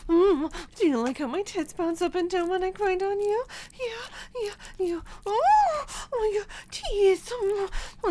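building to climax. Acted. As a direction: escalate from moans to cries. Mounting arousal to climax.

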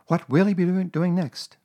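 high motherly wondering voice; marvelling Kanga. A narrator's reading. Kanga humouring Roo's clever imitation, marvelling.

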